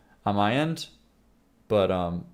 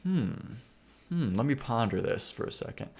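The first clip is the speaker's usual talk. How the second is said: mock pondering; 7 dB quieter than usual. Streamer acting out his answer for a reel, mock-thoughtful. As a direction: pretend to weigh it up. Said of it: "And I go".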